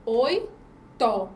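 "oi" and "to" said as separate beats; teacher splits "oi, to" into two clear syllables; syllables split apart. Shown as a demonstration. Teacher dividing oito for the class, syllable by syllable. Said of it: "Quando a gente vai separar a sílaba".

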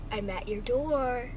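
a hint of a horror-movie stalker; creepy caller. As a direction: say it slowly and ominously. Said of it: "a man says".

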